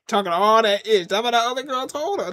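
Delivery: ordinary talk, nothing marked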